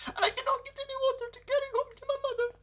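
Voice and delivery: put-on very high and fast, hurried young girl; girl's voice. A narrator's reading. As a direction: rush it out like a worried lassie.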